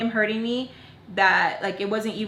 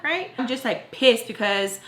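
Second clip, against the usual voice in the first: ordinary talk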